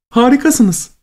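high, thrilled praise; delighted high voice. A narrator's reading. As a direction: gush with delight. Woman claps, delighted.

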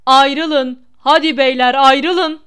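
full-voiced order to break it up; very loud, drawn-out yell. A narrator's reading. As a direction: bellow at brawlers to separate. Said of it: "daha da yüksek sesle bağırdı".

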